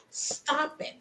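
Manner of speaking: ordinary delivery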